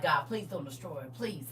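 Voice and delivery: soft, begging plea for mercy; Jesus softly begs God to spare her; quiet pleading Jesus. Acted. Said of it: "Jesus like".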